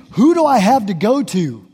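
ordinary talk

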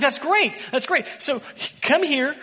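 high, loud retold enthusiasm; eager welcome. King welcoming the hired prophet, eager.

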